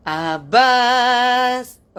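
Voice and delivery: slowly sounding out "Abbas" while reading it; very slow drawn-out name. Shown as a demonstration. Host sounding out a viewer's name, "Abbas" very slow. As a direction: stretch "Abbas" into one long slow syllable run. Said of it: "ചേ തെയിൽ".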